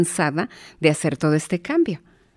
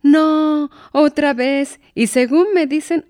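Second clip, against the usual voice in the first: exasperated mother; 9 semitones higher, slower than usual. Retold or imitated. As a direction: groan at it happening yet again. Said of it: "y la mamá piensa".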